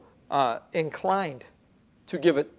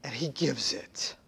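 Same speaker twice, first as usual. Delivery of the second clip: hushed whisper; 6 semitones lower, 10 dB quieter than usual. Acted. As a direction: drop to a whisper on he gives it. Whispered after loud plea.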